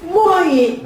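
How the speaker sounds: high, questioning repeat; baffled child. Acted. Ngongo child puzzled by the customer's order, baffled. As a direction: echo it in disbelief, pitched high.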